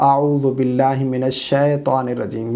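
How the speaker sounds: unstretched reading with الشیطان as "shetaan"; wrong recitation. Shown as a demonstration. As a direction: say الشیطان with a flattened "she", no y-glide. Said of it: "اس کو غلط پڑھتے ہیں اس طرح".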